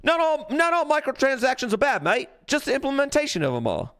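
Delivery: high, hammed-up Australian accent; mock Australian accent. Streamer re-reading the hot take as an Aussie, in a mock Australian accent. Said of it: "this guy's talking in Australian here".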